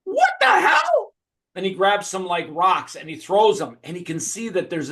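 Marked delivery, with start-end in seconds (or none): 0.0-1.1 loud, very high drawn-out shout; astonished hero. Acted. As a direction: squeal it in mock astonishment. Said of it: "he's like".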